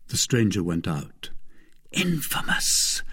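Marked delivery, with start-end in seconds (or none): 1.9-3.0 slow, unvoiced, scornful whisper. A narrator's reading. Wife scorning her husband in a hushed whisper. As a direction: spit the insult out under her breath. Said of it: "said Madame Bonacieux".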